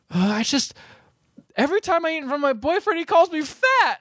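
mock tearful girlfriend. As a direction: squeak it out like she's playing the victim. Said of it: "She's like".

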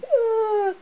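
long, very high scream; acted thin wail. A narrator's reading.

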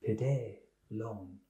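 put-on slow, soft French-accented delivery; quiet French lilt, drawn out. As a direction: keep the French accent while pretending to drop it. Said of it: "Do it without the French accent".

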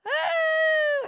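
loud, high-pitched "ahh"; long, sustained high holler; loud high cry. Real, not acted. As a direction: cut off your confession with a sudden high yell. Breaks off an over-personal confession.

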